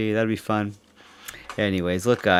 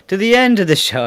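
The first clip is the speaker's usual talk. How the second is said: leprechaun voice; 10 semitones higher, 7 dB louder than usual. Pretend. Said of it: "I feel like saying, like, a leprechaun".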